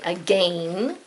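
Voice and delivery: "again" with a drawn-out long A, rhyming with "rain"; British pronunciation. Shown as a demonstration. Teacher showing how England says it, "again" with a long A. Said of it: "if you lived in England".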